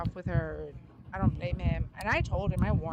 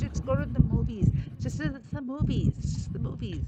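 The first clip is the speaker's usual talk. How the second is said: pleading, pitched up and louder; begging; 5 semitones higher, 4 dB louder than usual. Retold or imitated. She begs her cousin to go on a date, pleading and louder. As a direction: beg her again and again to just go. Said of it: "I begged her".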